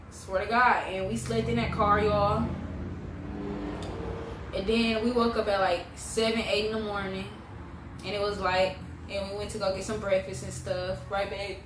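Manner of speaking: ordinary talk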